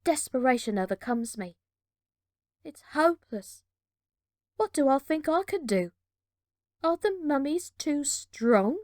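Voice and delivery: usual speaking voice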